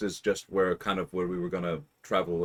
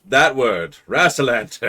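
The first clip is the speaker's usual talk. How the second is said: loud declaring; 5 semitones higher, 10 dB louder than usual. Retold or imitated. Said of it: "he throws out a map and he's like".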